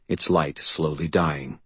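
plain reading voice

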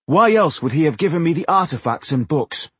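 high, insistent rhetorical question; impassioned. A narrator's reading. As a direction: press the point with fervour. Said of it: "his impassioned speech".

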